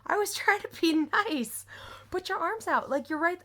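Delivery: high-pitched, pleading self-defense; exasperated protest. Retold or imitated. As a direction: re-enact an exasperated "I was only helping". Retells own protest in quarrel.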